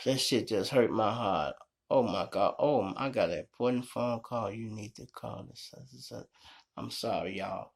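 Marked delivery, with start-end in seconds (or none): none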